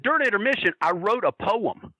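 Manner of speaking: a hint of a lady announcing her poem; lightly voiced lady. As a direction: pitch it up lightly to imitate an earnest audience member. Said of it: "one lady walked up to me and she said".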